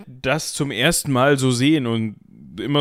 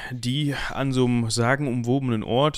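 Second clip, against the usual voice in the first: ordinary delivery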